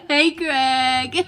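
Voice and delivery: loud crying laughter; helpless laughter. Real, not acted. Woman in fits laughing about her husband, crying laughter. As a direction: laugh till it hurts.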